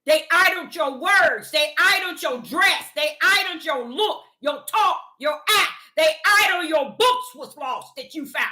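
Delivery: preaching. Acted. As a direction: preach it out, building to a cry. Preaching rebuke of idol ways.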